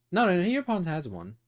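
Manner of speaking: quick, bright no no no; excited correction. Real, not acted. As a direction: rattle off the correction happily.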